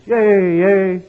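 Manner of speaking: high, loud cheering yay; mock cheer. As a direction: cheer like a delighted crowd. Workers cheering in joke.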